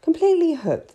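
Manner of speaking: usual speaking voice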